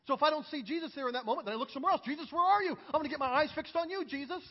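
high, loud, urgent preacher; fervent preaching. Acted. Preacher telling church where he looks for Jesus, fervently. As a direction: cry out to Jesus mid-sermon.

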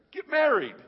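sudden high, loud burst; shouted command. Retold or imitated. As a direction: fire back the blunt answer as a shout. Acted exasperated answer to a questioner.

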